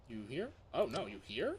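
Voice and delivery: ordinary talk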